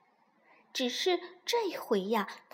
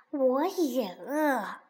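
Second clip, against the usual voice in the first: long drawn-out grumble; hungry fox; much slower than usual. Acted. Fox answers hungry duckling, slow drawn-out grumble. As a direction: stretch each word in a hungry complaint.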